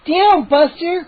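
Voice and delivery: slow, high command to the dog; girl commanding the dog; ordering. A narrator's reading. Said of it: "Mindy ordered".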